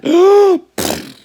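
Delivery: high, loud amazed gasp. A narrator's reading. Narrator gasps at the island, loud high gasp. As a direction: gasp in wonder.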